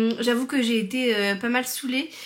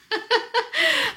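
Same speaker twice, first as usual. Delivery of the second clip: high laugh; 12 semitones higher than usual. Real, not acted. Laughs about husband's joy dance.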